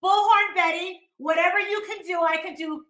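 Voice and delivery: normal talking voice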